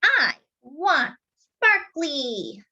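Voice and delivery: put-on high, slow pleading toddler; wanting little boy. Toddler brother asks for the skirt, slow and high. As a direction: stretch each word out, wanting it. Casey wants it.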